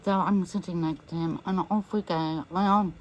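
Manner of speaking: ordinary talk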